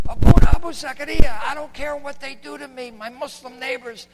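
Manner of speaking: imitated wounded man, ending in a whisper; imitating wounded Hana. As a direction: speak as the wounded man, straining. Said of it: "now he talks like this".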